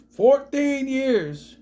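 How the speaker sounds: high, very slow, incredulous; astonished, dragged-out words; drawn-out disbelief. Real, not acted. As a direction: stretch it out in amazement. Disbelief at the number.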